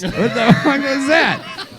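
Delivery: high-pitched, slow, laughing through the words; laughing disbelief. Real, not acted. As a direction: crack up at your own failed impression. Laughing at own botched accent.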